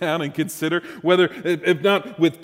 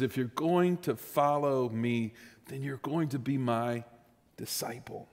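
hushed; 3 semitones lower, 8 dB quieter than usual. Shown as a demonstration. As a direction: lean in and let it fade to a breath. Same words, said hushed.

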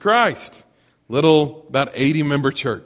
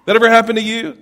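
lively, raised question to the crowd; excited question; 7 semitones higher, 4 dB louder, faster than usual. Real, not acted. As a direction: throw it out with a grin and a rising tune. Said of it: "asks the audience twice".

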